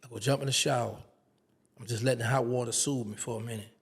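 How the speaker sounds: usual speaking voice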